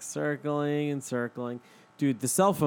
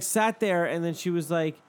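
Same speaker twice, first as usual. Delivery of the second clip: usual speaking voice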